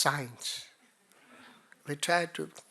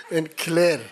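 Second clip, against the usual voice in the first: last word belted out, far louder; loud announcing; 3 semitones higher, 14 dB louder than usual. Real, not acted. Teacher finishing the program's name after laughing, loudly announcing. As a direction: proclaim the final word big.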